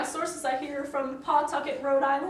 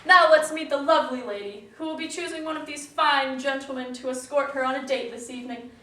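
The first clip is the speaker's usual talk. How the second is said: normal talking voice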